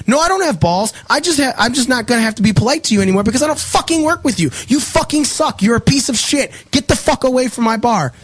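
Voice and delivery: pitched high, heated insults; retold angry outburst. Quitting bartender insulting the server on his last day, heated and high. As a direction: tear into her, voice high and hot.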